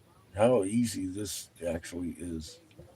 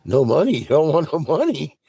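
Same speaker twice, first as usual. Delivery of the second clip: incredulous bystander; 7 semitones higher, 7 dB louder than usual. Retold or imitated. Crowd member quizzing him.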